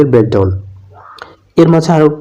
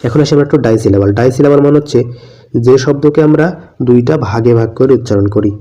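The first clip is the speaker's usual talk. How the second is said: normal talking voice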